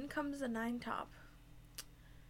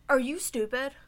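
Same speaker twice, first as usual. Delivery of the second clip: rude; 8 dB louder than usual. Retold or imitated. Rude customer quoted.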